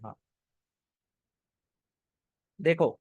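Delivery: ordinary talk, nothing marked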